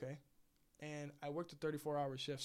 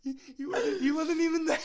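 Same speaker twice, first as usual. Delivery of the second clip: high, loud, helpless laughter through the words; cracking up; 12 semitones higher, 11 dB louder than usual. Real, not acted. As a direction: barely get the punchline out for laughing. Cracking up at own story's punchline.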